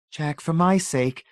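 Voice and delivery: wife pleading with husband. A narrator's reading.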